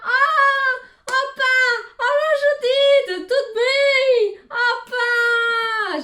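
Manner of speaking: loud, high, overjoyed greeting cries; hammed-up shouting welcome from Portuguese family; imitating loud relatives. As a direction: burst out like the relatives welcoming her home. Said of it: "Genre, ils sont tous comme ça".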